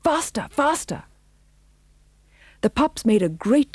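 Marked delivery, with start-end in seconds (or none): 0.0-1.1 high, urgent urging; pitched-up cry without extra volume. A narrator's reading. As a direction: hurry the troop along. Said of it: "cried Pongo".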